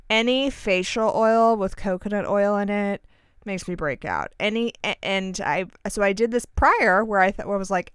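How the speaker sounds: ordinary talk, nothing marked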